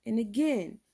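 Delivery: ordinary delivery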